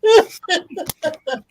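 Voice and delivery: high-pitched burst of laughter; amused high laugh; real laughter. Real, not acted. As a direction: laugh high, falling away at the end. Laughs at the weight joke.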